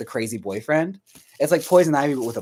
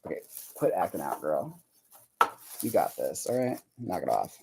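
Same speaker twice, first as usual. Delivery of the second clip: low soothing scold; 5 semitones lower, 7 dB quieter than usual. Real, not acted. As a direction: murmur it down low to calm her. Scolding the thing she is working on.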